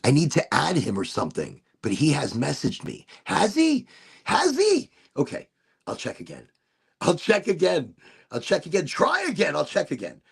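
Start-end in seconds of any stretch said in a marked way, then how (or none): 3.2-4.9 high surprised question; genuinely taken aback, rising high. Real, not acted. Surprised at message.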